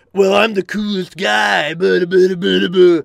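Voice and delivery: slow, loud, high boastful voice; smug show-off. Retold or imitated. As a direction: puff up like a show-off GM. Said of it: "the D M has that power trip".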